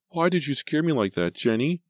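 put-on high, hurt little-boy whine; plaintive, high-pitched wail; bawling child. A narrator's reading. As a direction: reproach big sister like an upset child. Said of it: "he bawled".

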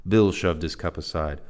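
ordinary delivery, nothing marked

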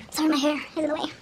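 ordinary talk

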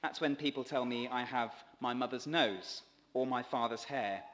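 ordinary delivery

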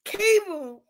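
ordinary talk

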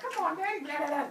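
put-on high, loud whining children; whiny kids. Hundred-handed kids whining at Father Sky, high and pleading. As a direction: beg Daddy in a squeaky whine.

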